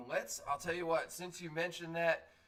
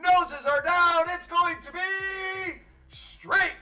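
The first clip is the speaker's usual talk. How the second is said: race call at full force; 11 semitones higher, 11 dB louder than usual. Acted. Announcer calling horses to the finish, building shouted play-by-play. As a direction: drive it higher and louder to the line. Calls the race's stretch run.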